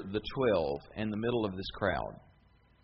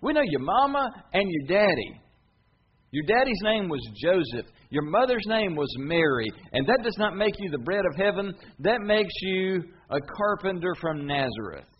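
loud, higher mocking retort; sarcastic crowd; 6 semitones higher, 5 dB louder than usual. The crowd taunts Jesus about his parents, loud and sarcastic. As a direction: sneer back at him, sarcastically. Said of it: "kinda sarcastically".

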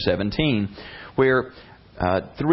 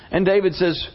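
normal talking voice

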